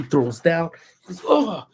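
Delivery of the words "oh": higher-pitched exasperated outburst, imitating him; mock tantrum. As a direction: act out his fit. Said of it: "throws the biggest fit".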